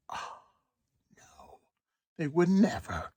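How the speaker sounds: whispered no, then a light mock-innocent line; sarcastic mock disbelief. Vlogger mocking the idea that Fed chairs cheat, sarcastic. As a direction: feign shock, dripping irony.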